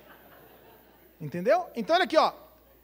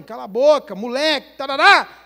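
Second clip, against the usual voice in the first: loud, high shout at the son; angry shout; 4 semitones higher, 14 dB louder than usual. Retold or imitated. Father at the dinner table shouting at his son, angry. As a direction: bark it at the boy across the table.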